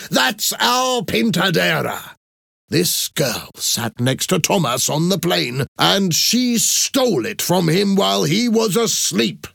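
high, excited outcry building to accusation; exclaim, then accuse heatedly; shouting accusation. A narrator's reading. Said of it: "shouted Professor Toledo".

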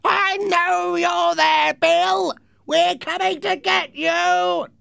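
harsh, drawn-out villain's shout; raspy roar at people behind a door; menacing shout. Acted. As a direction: shout a slow threat in a rasping, strained voice. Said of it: "Then he shouted".

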